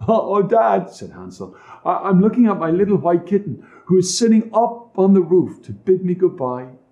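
put-on childlike boy. A narrator's reading. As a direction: stammer like a little boy.

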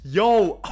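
loud yo, then a very high squeal of laughter; cheering and cracking up; whooping laugh. Real, not acted. Celebrating the returned sword.

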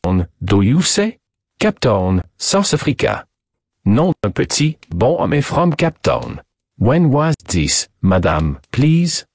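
put-on thick French accent, drawn-out questioning; French detective, astonished. Detective reacts to Cape Town news, French-accented surprise. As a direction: play a startled French sleuth pressing for facts.

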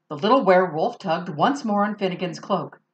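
plain reading voice

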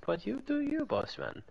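lightly teasing German accent. Shown as a demonstration. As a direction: shrug it off in a mock German accent. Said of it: "It's very clearly a German accent".

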